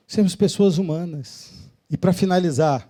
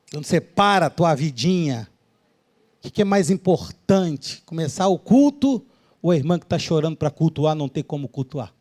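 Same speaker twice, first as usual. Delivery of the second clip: ordinary talk, nothing marked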